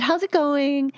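high, lilting Southern greeting; Southern accent. Retold or imitated. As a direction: imitate a Southern woman's cheery hello. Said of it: "She had this heavy Southern accent".

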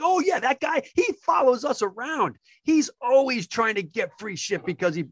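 high-pitched, animated recognition; knowing manager. Retold or imitated. Manager told about a lookalike freeloader, animated and knowing. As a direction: pitch jumps up, as if he knows this guy all too well.

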